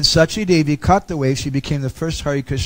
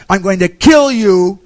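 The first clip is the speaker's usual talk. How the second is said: "kill" hammered loud and high, slow; furious threat; 5 semitones higher, 8 dB louder, slower than usual. Retold or imitated. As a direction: bellow "I'm going to kill you" like a raging god. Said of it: "started yelling at him".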